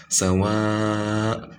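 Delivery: Sawa with a held, drawn alif; long muttasil mad. Shown as a demonstration. Teacher demonstrating mad muttasil on Sawa. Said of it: "which is here".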